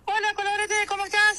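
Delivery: put-on high, childlike little boy voice; voz de nene. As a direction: talk like a small child. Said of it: "habla como nene". (voz aguda de nene)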